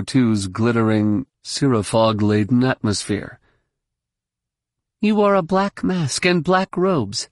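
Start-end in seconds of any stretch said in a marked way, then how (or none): 5.0-7.3 put-on high-pitched young challenger; high, pointed accusation; accusing. A narrator's reading. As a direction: confront him in a much higher voice. Jaina confronts the villain.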